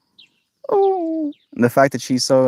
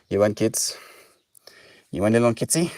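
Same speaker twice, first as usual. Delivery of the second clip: usual speaking voice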